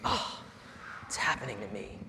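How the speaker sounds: hushed oh my gosh, it's happening to me; whispered realization. Retold or imitated. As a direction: breathe it out like a dawning dread. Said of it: "And I was like".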